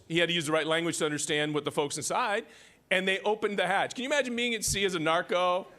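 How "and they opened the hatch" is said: ordinary talk, nothing marked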